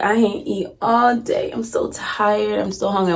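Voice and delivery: slow, drawn-out mock whine; feigned exhaustion. Telling grandmother of a fake fast, feigned exhaustion. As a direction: drag it out as if about to drop. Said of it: "acting like I'm about to pass the hell out".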